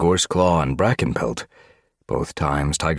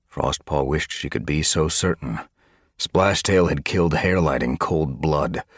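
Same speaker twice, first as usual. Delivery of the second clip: ordinary delivery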